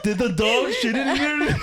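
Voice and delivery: high, incredulous question; roommate voice. Retold or imitated. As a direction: ask it high and baffled. Roommate quoted asking.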